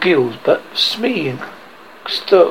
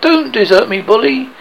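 plain reading voice

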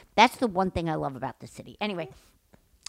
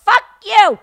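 high, very loud yell; retold angry retort; 13 semitones higher, 13 dB louder than usual. Joining her friend against the dog owner, loud angry yell. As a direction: join in with a shouted insult. Own retort in shouted quarrel.

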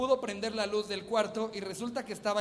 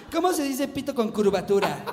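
mocking dumb guy; 4 semitones higher, 7 dB louder than usual. Mock quote of the sender.

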